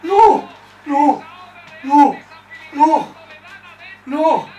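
repeated loud cries of no, high and strained; anguished shout. Real, not acted. Barça fan seeing the shot miss, shouting in real anguish. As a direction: yell as the chance slips away.

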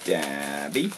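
deep, hushed creepy murmur; creepy low voice. Acted. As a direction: croon it like a gleeful villain. Playful stabbing at box.

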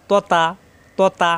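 heavy rounded 'ত', then light open 'তা'; thick then thin. Shown as a demonstration. Teacher drilling the difference in reverse, thick 'ত', thin 'তা'. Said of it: "ত মোটা, তা পাতলা".

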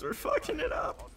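high, squeaky panicked repeat; frantic squeal. Real, not acted. Losing the fight, repeated.

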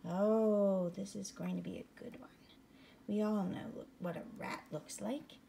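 ordinary delivery

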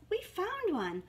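high, proud mouse voice; lightly voiced mice, pitched high; squeaky baby mice. A narrator's reading. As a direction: squeak out the find. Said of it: "squeaked the baby mice".

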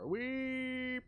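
ordinary talk, nothing marked

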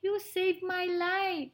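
high, grateful mouse voice; little mouse, grateful. Acted. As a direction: thank the lion as a tiny mouse.

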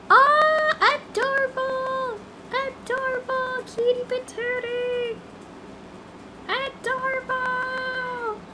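high, slow cutesy coo; soft sing-song doting voice; cooing baby talk. Acted. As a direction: gush over a cute pet. Cooing over a cute character.

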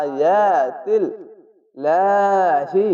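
slow, drawn-out chanted recitation; Quran recitation. Shown as a demonstration. Teacher demonstrates for students: slow melodic recitation. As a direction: intone the word like tajweed reading. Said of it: "খাড়া জবর এক আলিফ টেনে পড়বেন".

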